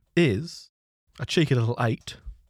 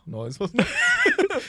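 laughing shout; 11 semitones higher, much slower than usual. Real, not acted. Laughing shout while recalling.

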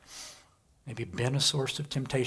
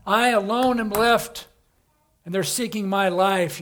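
desperate Elijah; 7 semitones higher, 9 dB louder than usual. Acted. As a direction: plead like a hunted man. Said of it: "Elijah comes back and says".

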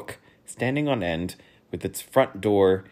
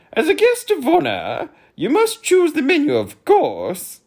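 high, loud hearty humbug voice; jovial pompous humbug; cordial humbug; 8 dB louder than usual. Acted. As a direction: greet him like an old chum. Humbug continues speaking.

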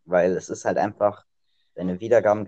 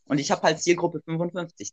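excited; 8 semitones higher, 4 dB louder than usual. Real, not acted. Eagerly proving his numbers right.

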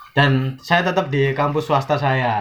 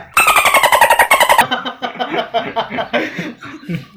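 high squealing laugh. Real, not acted. Bursts out laughing mid-sentence.

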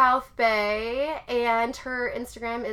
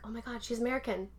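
whisper; 13 dB quieter than usual. Retold or imitated. Surprised by the American couple.

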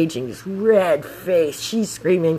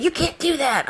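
imitated yelling guy; 13 semitones higher than usual. Van driver objecting to scooter passing, high mock yell. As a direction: mimic an indignant driver squawking up high. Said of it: "I had a guy yell at me".

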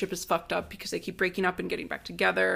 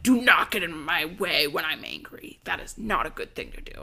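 menacing warning; 2 semitones lower than usual. Retold or imitated. Ricky blocks his girlfriend and warns her, coldly menacing. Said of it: "that's really scary behavior".

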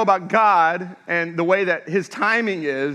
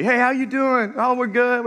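mock friendly cop; 6 semitones higher than usual. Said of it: "super friendly".

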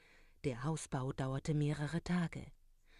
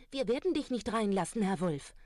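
high, loud and fast; pigs' hurried refusal; 6 semitones higher, 4 dB louder than usual. Acted. Two pigs hurriedly answer the wolf, high, loud and fast. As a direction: blurt out the no in a rush.

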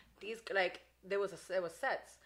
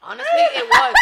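shouted through laughter, much higher and louder; laughing shout; 14 semitones higher, 15 dB louder than usual. Real, not acted. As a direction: blurt it out cracking up.

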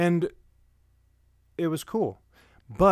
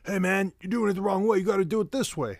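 higher, quick mock yelling coworker; 7 semitones higher than usual. Second coworker yelling about the wrong way. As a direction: yell it like a pushy coworker correcting him. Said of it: "starts yelling".

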